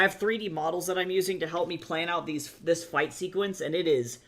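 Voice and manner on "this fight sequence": ordinary talk, nothing marked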